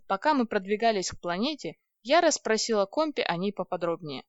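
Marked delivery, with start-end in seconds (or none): none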